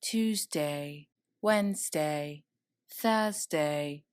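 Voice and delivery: soft, low listing of days; quiet. Acted. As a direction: murmur the days away. Days passing in montage.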